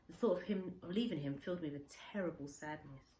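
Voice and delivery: plain reading voice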